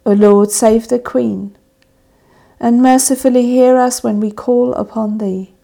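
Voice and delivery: ordinary talk, nothing marked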